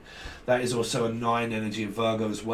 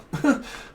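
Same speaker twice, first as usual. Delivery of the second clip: high laugh; 3 dB louder than usual. Real, not acted. Laughs at forgotten recording.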